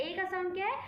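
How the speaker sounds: ordinary talk, nothing marked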